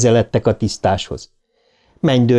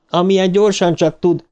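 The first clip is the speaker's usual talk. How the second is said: higher, pressing and urgent; urgent demand; 8 semitones higher than usual. A narrator's reading. Eddie demanding the night porter put him through, urgent. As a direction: get through to him, fast. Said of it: "mondta Eddie".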